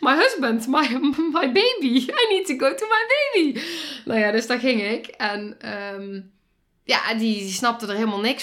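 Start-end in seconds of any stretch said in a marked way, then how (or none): 0.1-3.6 put-on high, louder pleading for her baby; frantic pleading. Mother begging the nurse to see her baby, high frantic plea. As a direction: beg to be taken to the baby.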